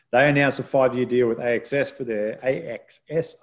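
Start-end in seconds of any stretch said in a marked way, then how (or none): 2.4-3.4 "A X S" as three careful, higher-pitched letters; said slowly. Shown as a demonstration. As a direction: spell out "A X S" letter by letter. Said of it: "I'll say that slowly".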